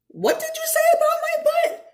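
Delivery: put-on very high, loud indignant girl shriek; outraged shout. A narrator's reading. Ella rounds on Herbie over the butt remark, high outraged shriek. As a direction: shriek it like she can't believe what she heard. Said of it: "She spun around and pointed".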